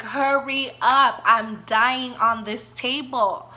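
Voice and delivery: stretched-out, insistent urging; urgent retold plea. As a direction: drag out an exasperated demand for help.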